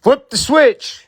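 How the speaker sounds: high, slow command; executioner's order. Pretend.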